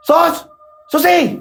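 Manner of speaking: very high, sharp summons; calling out. Acted. Parent summoning Susi out, high urgent call. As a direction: call her from another room.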